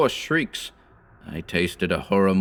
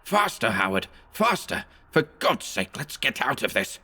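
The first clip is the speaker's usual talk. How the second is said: shrieking in fright; 4 semitones higher than usual. A narrator's reading. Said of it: "I shrieked aloud".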